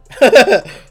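loud high cackle; loud burst laugh. Real, not acted. Vlogger laughing at himself, loud high laugh. Laughs at own hug fantasy.

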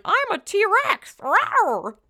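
put-on high, slow growling child dinosaur; kid playing T-Rex. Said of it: "I was like".

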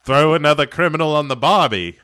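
hammed-up Aussie-Brooklyn accent; botched Brooklyn accent. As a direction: parody an Australian failing at a Brooklyn accent. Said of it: "trying to do a Brooklyn accent".

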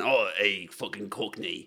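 half-hearted rough Cockney demo; Cockney accent. Shown as a demonstration. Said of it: "you could have had Cockney".

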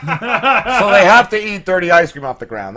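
laughing through the words; slow, giggling telling. Real, not acted. Laughing at kid's joke.

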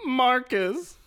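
high, loud, drawn-out name; hammed-up showdown reply; mock western standoff. Co-host answers the host's showdown greeting.

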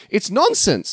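high-pitched incredulous dismissal; exasperated. Real, not acted. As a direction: throw it out in exasperation. Exasperated verdict on convoluted plot.

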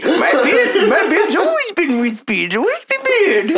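put-on high old man voice, laughing through it; little old shopkeeper, pitched high; tiny old man voice. Shopkeeper answers in character.

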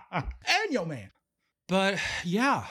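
normal talking voice

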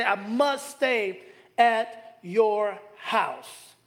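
very slow, must leaned on; slow emphatic line. Shown as a demonstration. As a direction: let each word land. Preacher repeats key line slowly.

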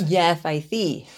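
slow lisped SEE, s said as th; mock lisp. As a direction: lisp the s in "Yes, I see" pointedly. Mock lisp answering lisp lecture.

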